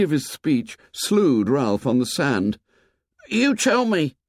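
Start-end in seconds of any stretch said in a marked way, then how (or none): 3.3-4.2 anxious. A narrator's reading. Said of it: "said Piggy anxiously".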